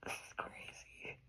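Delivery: slow whispered disbelief; real shaken whisper; awed whisper. Real, not acted. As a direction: whisper it, overwhelmed. Overwhelmed by the reading.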